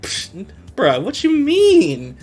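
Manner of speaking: high, slow mocking "what you mean"; cocky scoff. Demon mocking Kuwabara's challenge, slow and high. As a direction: scoff like the question is stupid. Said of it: "he says, like".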